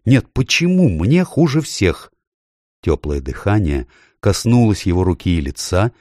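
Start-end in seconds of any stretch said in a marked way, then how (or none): 0.0-2.1 put-on high, pleading scared boy; frightened boy. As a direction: beg it to go away in fright. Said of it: "Шаста вдруг страшно испугался".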